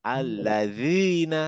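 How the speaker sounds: আল্লাযিনা drawn out slowly, with a dh sound and the tongue between the teeth; very slowly, like a teacher modelling the word; correct Arabic pronunciation. Shown as a demonstration. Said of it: "সম্পূর্ণ নতুন উচ্চারণ". (ধীরে ধীরে শুদ্ধ আরবি উচ্চারণ)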